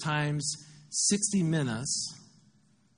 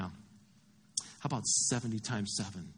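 slow, low, softer reply; calm correction; 6 semitones lower, 6 dB quieter, slower than usual. Acted. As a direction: correct him quietly, taking your time. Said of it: "And Jesus is like".

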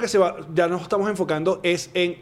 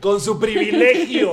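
high, slow, drawn-out mock outrage; mock declamation; 4 semitones higher, 4 dB louder, slower than usual. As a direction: chime in with exaggerated slogan pomp. Joins in the mock slogan.

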